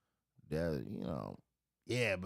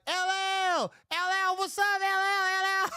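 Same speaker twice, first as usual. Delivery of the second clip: very high, loud yelling name; fan shouting; 7 dB louder than usual. Retold or imitated. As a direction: scream out to the star in his car. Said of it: "we was like".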